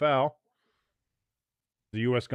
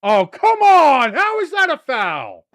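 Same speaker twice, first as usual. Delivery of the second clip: high, drawn-out outraged shout; indignant protest; 12 semitones higher, 8 dB louder, slower than usual. Real, not acted. Fan-commentator disputes a foul call, indignant shout. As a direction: yell in disbelief at the referee.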